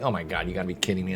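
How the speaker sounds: low, fast mumbled disbelief under the breath. Retold or imitated. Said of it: "I was like".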